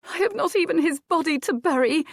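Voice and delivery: high, loud grief-stricken cry; weeping. Acted. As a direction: wail it through tears. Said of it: "Adair wept".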